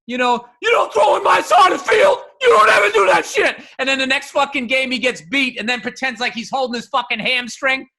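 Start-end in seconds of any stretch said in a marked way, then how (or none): 0.6-3.6 loud, high shouted trash talk; trash-talking cornerback. Retold or imitated. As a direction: bark it like a cocky cornerback. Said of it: "the fucking cornerback going".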